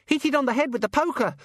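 put-on very high-pitched child cheering on; high child voice, gleeful egging-on; excited boy. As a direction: squeal it as an eager little boy. Boy Gawain eggs Susan on.

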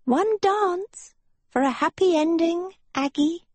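high, pleading hag voice; pleading witch voice. A narrator's reading. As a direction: beg sweetly like a witch.

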